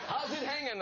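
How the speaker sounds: high, breezy pal greeting; mock chummy greeting. Greeting guests whose names he forgets, breezy and high. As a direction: toss it off like a buddy. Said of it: "I say".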